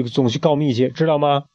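usual speaking voice

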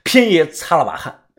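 plain reading voice

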